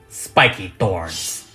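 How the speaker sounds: normal talking voice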